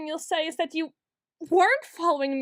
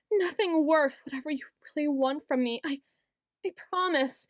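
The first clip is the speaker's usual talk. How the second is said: normal talking voice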